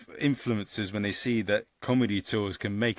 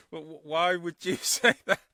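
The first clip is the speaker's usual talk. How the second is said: slow, higher, laughing through it; laughing question; 7 semitones higher, slower than usual. Real, not acted. Host asking about the saying through laughter. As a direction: ask it while still laughing.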